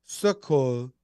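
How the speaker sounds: French pronunciation demo; careful French accent, for a learner. Shown as a demonstration. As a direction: slowly sound out the French word. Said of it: "do a French accent".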